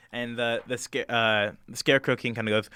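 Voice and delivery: usual speaking voice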